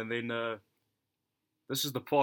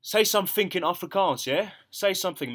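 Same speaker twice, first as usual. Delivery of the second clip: insistent; 7 semitones higher, 7 dB louder than usual. Retold or imitated. Guy retold demanding.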